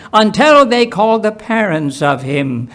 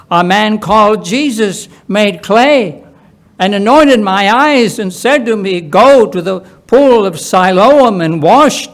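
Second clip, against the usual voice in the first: raised, louder telling of his cure; healed blind man; 5 semitones higher, 5 dB louder than usual. Acted. Healed man telling neighbours how he was cured, raised and earnest. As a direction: tell it higher and louder, eager to be believed.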